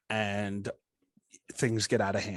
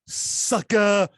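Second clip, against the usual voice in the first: higher, louder mock catchphrase; Booker T catchphrase; 8 semitones higher, 8 dB louder than usual. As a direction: spit it out like a wrestler's taunt.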